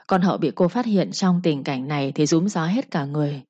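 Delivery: usual speaking voice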